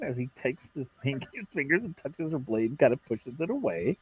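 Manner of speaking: usual speaking voice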